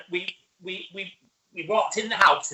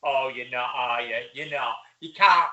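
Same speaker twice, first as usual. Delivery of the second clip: mock doubtful partner, much lower; imitating Andrew; 13 semitones lower than usual. Wife doubting the dinner party plan, low mock voice. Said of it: "and she went".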